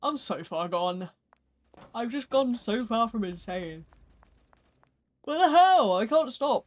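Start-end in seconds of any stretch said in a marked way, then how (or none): 5.2-6.6 high, exasperated exclamation, loud at first; exasperated outburst. Real, not acted. Streamer caught yawning endlessly, exasperated. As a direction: burst out, baffled and fed up.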